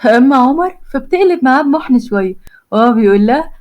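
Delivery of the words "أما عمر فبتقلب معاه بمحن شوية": usual speaking voice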